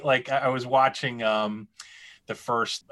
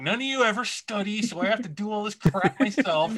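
mock Hermione; 8 semitones higher than usual. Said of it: "It's just like".

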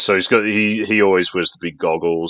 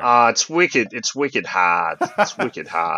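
hammed-up Boston accent, drawn out; 4 semitones higher, slower than usual. Showing off a requested Boston accent for podcast co-hosts. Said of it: "Do a Boston accent, [name]".

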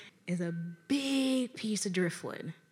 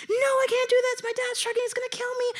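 high-pitched mock whine; panicked protest; 13 semitones higher, much faster than usual. Boyfriend fearing his dad, panicked protest. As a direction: imitate a frantic refusal, voice high.

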